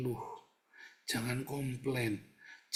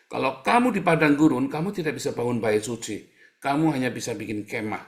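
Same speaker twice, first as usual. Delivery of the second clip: loud preaching; 7 dB louder than usual. Acted. Preacher building to a loud point.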